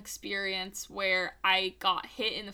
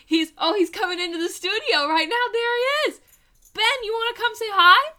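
higher, louder, excited; excited announcement; 10 semitones higher, 6 dB louder, faster than usual. Acted. Excited announcing Stiller arrival.

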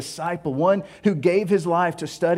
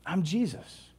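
a soft, quieter Jesus; 8 dB quieter, slower than usual. Acted. Jesus naming himself to Saul, softly. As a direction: drop it down quietly. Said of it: "Who are you".

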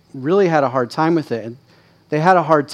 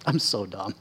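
muttered self-reproach; 5 semitones lower, 11 dB quieter than usual. Retold or imitated. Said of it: "and I'm like".

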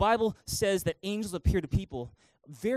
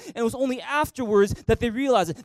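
ordinary talk, nothing marked

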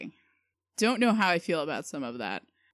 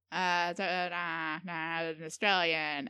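fumbling for an Australian accent; attempted Australian accent; 5 dB quieter, slower than usual. Shown as a demonstration. Guest warming up on request, fumbled Australian accent. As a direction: feel out Australian vowels, laughing at yourself. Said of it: "nail that Australian accent".

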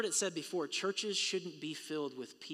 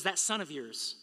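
higher and louder, spitting out yours; contemptuous quote; 4 semitones higher, 6 dB louder than usual. Retold or imitated. Older son disowning his brother, scornful.

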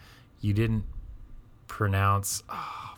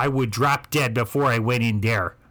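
higher, loud indignant Midwest grandparent; Midwest accent; 3 semitones higher, 3 dB louder than usual. Retold or imitated. Grandparent refusing to enter the market, Midwest accent. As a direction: huff it out in a Wisconsin accent. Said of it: "the Midwest accent is real".

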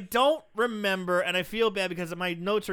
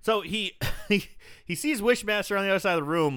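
ordinary talk

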